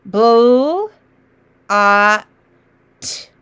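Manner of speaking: each sound "Bull", "ah", "t" said apart and held; separate sounds. Shown as a demonstration. Teacher to class, sounding out letter by letter. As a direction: sound out bull, ah, t one by one with gaps. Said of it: "I'm gonna do just the sounds".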